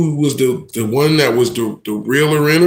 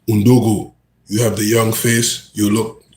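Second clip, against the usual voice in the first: put-on African accent, a little lower; 4 semitones lower than usual. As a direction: play the African ringleader giving orders. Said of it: "That's my best African accent right now".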